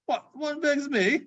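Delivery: put-on a cheeky street boy; boy's voice. The boy asking Scrooge, in a high child's voice.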